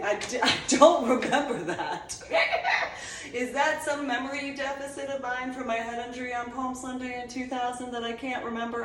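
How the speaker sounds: ordinary talk, nothing marked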